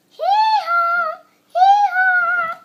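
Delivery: very high, loud hee-haw; shouted braying hee-haw; donkey bray. Acted. Child imitating a donkey.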